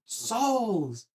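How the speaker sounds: stretched-out battle cry; screaming at himself. Retold or imitated. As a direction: roar it to push through the pain. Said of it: "I was just screaming at myself".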